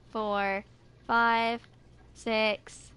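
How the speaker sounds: ordinary talk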